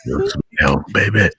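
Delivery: low, soft creepy croon. Acted. Echoing creepy line.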